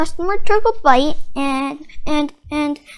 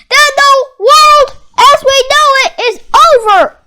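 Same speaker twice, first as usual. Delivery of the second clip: high, much louder despairing cry; dramatic cry; 11 semitones higher, 12 dB louder than usual. Acted. Mister Krabs to the reporter, wailing doom. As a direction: wail it like the world is ending. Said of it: "cried Mister Krabs".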